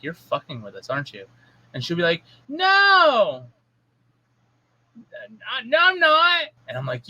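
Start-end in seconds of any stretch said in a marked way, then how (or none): 0.0-1.3 much softer, knowing aside; quiet accusation. Retold or imitated. Parent looking at whining daughter, quietly accusing. 2.5-6.5 very high-pitched mock kid whine; whining child. Daughter denying she is faking, high whine. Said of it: "and she'll whine".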